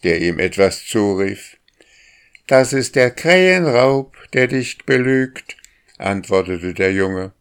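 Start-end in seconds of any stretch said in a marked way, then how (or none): 2.5-5.6 high, loud cheeky call. A narrator's reading. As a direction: shout it down teasingly. Boy calls down to bird.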